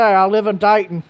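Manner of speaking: "Dighton" said with a long I, like "die-ton"; mock Ohio accent. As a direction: tease the locals' "Dighton", rhyming with "bite". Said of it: "Y'all say".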